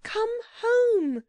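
very high pleading call; desperate cry. A narrator's reading. Girl begs everyone to flee the disaster, crying out. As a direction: beg in a high, urgent cry. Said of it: "cried Anthea".

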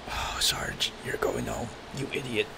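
whispered, teasing; mock whisper. Real, not acted. Gamer teasing his dumb character Sarge, whispered taunt. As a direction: breathe it like a secret taunt.

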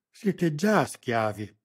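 slow, loud, final; curt dismissal; cutting short. A narrator's reading. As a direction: shut her down flatly and slowly. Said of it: "tagliò corto l'uomo".

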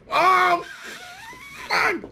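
high sudden yelp of burning pain; pained shout. Real, not acted. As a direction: yelp as the heat hits. Bites super spicy chip.

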